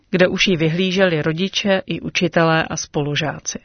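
ordinary delivery

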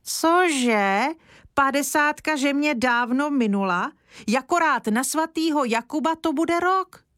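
hands on hips, let him have it; granny scolding grandpa about her age; indignant tirade. A narrator's reading. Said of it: "ruce v bok a spustila".

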